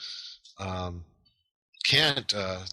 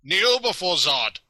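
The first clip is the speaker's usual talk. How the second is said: raised, loud, menacing tyrant voice; General Zod voice; 8 semitones higher, 5 dB louder than usual. Retold or imitated. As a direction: demand submission like a dark conqueror. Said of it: "They gotta throw in the line".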